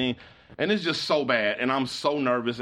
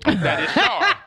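squeaky, much higher and louder through laughter; laughing; 8 dB louder, slower than usual. Real, not acted. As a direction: crack up while saying it. Cracks up at the memory.

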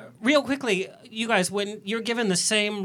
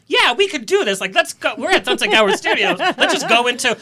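excited; 5 semitones higher, 7 dB louder than usual. Retold or imitated. Excited retold thought.

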